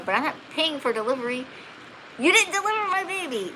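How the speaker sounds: indignant arguing. Retold or imitated. New mother refusing to pay for delivery, indignant. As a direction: pitch climbing in retold protest. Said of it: "And I argued with them".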